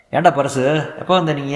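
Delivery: jovial elder husband; hearty husband. Acted. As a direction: call out to the young man warmly. Husband greets guest heartily.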